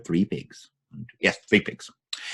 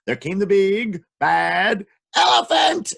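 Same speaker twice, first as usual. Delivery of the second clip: slow, louder, higher villain announcement; dramatic announcement; 7 semitones higher, 5 dB louder, slower than usual. Acted. Storyteller announcing the villain with a big shout. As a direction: build it up like a big reveal. Second dramatic entrance try.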